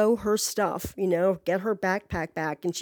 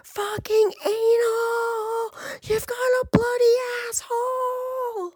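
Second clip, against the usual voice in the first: very high, drawn-out mock screech; slow, high-pitched imitation yell; retold scream; 14 semitones higher, slower than usual. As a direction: imitate her screaming down the street, each word stretched. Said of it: "just still screaming out".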